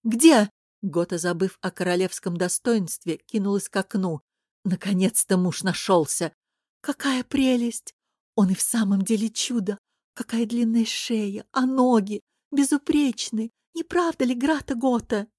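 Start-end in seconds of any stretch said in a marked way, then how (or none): 0.0-0.5 loud, high one-word question; urgent. Acted. Gota rushes to the window for her husband, urgent. 6.8-15.3 gushing admiration. Acted. Ladies marvel at the stallion to Gota, gushing.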